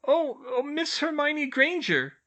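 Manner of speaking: high, slow faltering elf voice; stammering elf. A narrator's reading. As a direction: caught off guard and embarrassed. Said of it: "Winky stammered".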